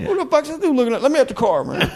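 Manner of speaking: high squeaky cartoon dog voice; dog character voice. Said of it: "I'm actually going".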